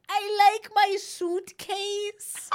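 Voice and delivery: put-on very slow, high small-child voice; little boy voice. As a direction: drag it out like a tiny kid. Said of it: "he's little and he goes".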